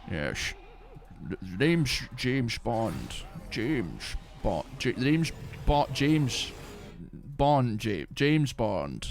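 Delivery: put-on slushy Scottish accent, esses turned to sh; slow, drawn-out Scottish Bond introduction; Sean Connery. Streamer demonstrating a puritanical Scottish accent, Scottish impression. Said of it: "like, Sean Connery".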